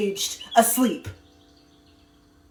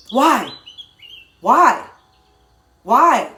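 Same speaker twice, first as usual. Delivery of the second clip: insistent why; 6 dB louder, much slower than usual. Real, not acted. Repeated rhetorical why.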